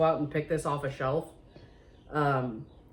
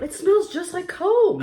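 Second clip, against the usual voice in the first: excited; 12 semitones higher, 5 dB louder, slower than usual. Real, not acted. Excited first sniff of cookies.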